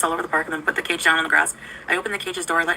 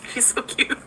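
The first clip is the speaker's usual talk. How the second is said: normal talking voice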